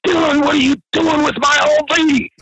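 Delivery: high, mock-outraged yell; retold angry confrontation. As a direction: imitate a jealous man bursting in. Acted jealous man bursting in.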